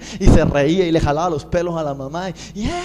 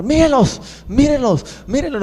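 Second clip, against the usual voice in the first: high, loud excited cry; excited calling out; 9 semitones higher, 5 dB louder than usual. Retold or imitated. A mother in the hospital excitedly points out the preachers. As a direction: call out across the hospital hallway.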